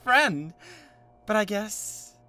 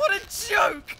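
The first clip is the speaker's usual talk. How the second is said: high, loud mocking cry; scornful shout; 8 dB louder, slower than usual. Jealous rival mocks the other suitor, scornful shout. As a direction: laugh him off with a contemptuous shout.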